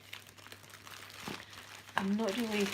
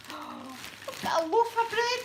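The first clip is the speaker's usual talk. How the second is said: high squeal of delighted surprise; thrilled; 10 semitones higher than usual. Real, not acted. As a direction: squeak it out in amazement. Thrilled by bread in parcel.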